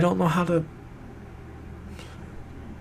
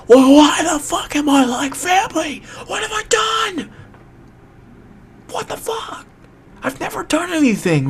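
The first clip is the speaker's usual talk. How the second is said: distraught outburst; 14 semitones higher, 6 dB louder than usual. Real, not acted. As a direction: scream it out, utterly at a loss. Outburst of upset bewilderment at friend.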